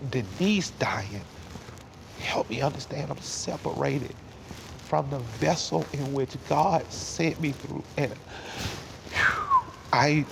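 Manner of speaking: real choked-up voice, halting with long breaths; tearing up. Real, not acted. Son talking of losing his mother, tearing up. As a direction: push through tears, pitch lifted and unsteady in level. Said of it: "tearing up thinking about her".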